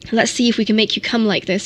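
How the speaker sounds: ordinary delivery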